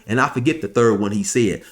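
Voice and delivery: ordinary talk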